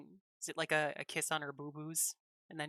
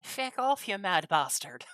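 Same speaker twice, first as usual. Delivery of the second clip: put-on Irish accent; 3 semitones higher, 4 dB louder, slower than usual. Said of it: "It's my terrible Irish accent".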